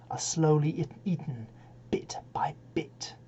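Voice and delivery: soft, sinking to a whisper; hushed gruesome relish. Acted. Reciter describes Jim being eaten, hushed near-whisper. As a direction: drop away to a whisper, piece by piece.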